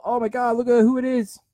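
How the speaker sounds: a hint of starstruck excitement; imitating starstruck fans. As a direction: gasp it out like a starstruck fan. Fans spotting someone famous.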